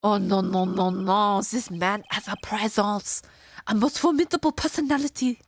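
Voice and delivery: lightly hammed-up fake French accent; terrible French accent. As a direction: play the nervous Frenchman with a bad accent. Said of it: "I love doing terrible French accents".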